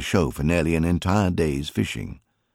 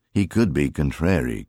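plain reading voice

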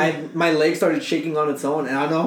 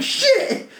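mock pain yelp. Body's pain cry imitated.

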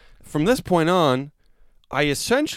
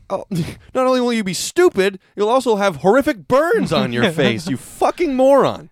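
much higher and louder, taunting threat; high yell; 9 semitones higher, 4 dB louder than usual. Retold or imitated. Inner taunt with hot coffee in hand, high yell. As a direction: dare him with a shriek. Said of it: "I'm like, in my head".

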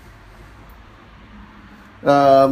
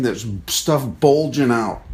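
usual speaking voice

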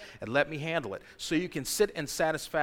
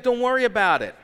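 casual God voice; 7 semitones higher, 9 dB louder than usual. Retold or imitated. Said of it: "He's like".

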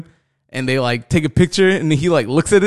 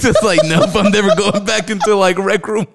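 higher voice, still full of laughter; laughing retelling; 7 semitones higher than usual. Real, not acted. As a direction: swear off the game while cracking up. Still laughing at own story.

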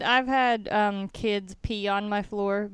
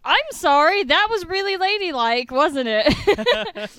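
much higher and louder, sheepish mock-proper; embarrassed joking apology; 8 semitones higher, 9 dB louder, slower than usual. Clerk to customer after a burp, embarrassed mock apology. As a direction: laugh off the burp with loud fake politeness.

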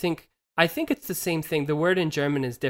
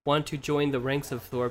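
usual speaking voice

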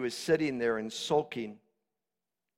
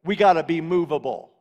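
high, loud shouted exhortation; full-force preaching; 12 semitones higher, 12 dB louder than usual. Acted. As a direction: fire commands out at the congregation like a fiery preacher.

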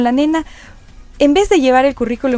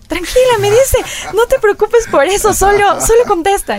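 reassuring father; 10 semitones higher, 3 dB louder than usual. Retold or imitated. Father reassures her on phone call.

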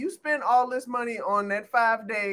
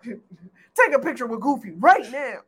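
laughing mock dad order; 6 dB louder than usual.

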